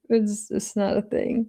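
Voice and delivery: ordinary delivery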